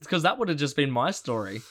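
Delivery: usual speaking voice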